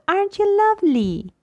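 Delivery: put-on high, falling coo to a baby; baby talk. Shown as a demonstration. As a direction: gush over the baby, high and sing-song. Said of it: "We talk to it".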